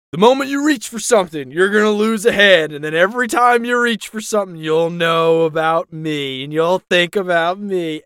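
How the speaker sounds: a retold villain yelling at the crowd; mock screaming demands. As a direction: bark threats like a power-tripping villain. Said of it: "screaming demands and stuff".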